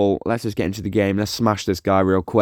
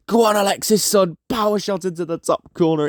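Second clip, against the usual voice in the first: excited cheer; 9 semitones higher than usual. Real, not acted. Cheering a goal chance.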